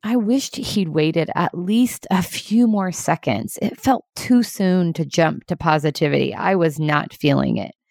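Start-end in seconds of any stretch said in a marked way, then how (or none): none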